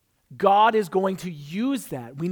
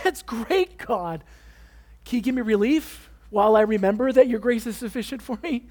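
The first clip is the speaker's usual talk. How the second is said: sarcastic retort to God; 4 semitones higher, 4 dB louder than usual. Retold or imitated. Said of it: "And I'm like".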